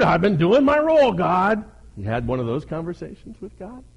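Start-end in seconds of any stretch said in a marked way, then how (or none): 0.0-1.6 high, loud mock complaint; whining. Elijah tells God he's done his role, whining. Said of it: "and he whined".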